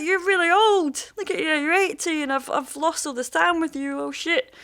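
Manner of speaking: high-pitched retold lament, easing down at the end; dismayed dad. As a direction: act out a father realising what he has missed. Dad apologising to aged son.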